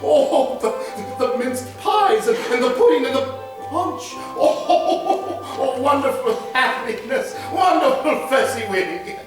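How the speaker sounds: giddy with joy at the party; delighted laughing. Acted. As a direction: Scrooge laughing with delight at the dance. Scrooge watching the party.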